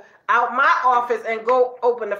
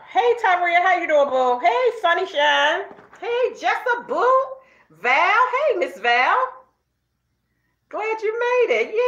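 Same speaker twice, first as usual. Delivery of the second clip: excited greeting; 7 semitones higher than usual. Real, not acted. Vlogger greeting viewers as they join, high and excited. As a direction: greet each one in a high, lifted lilt.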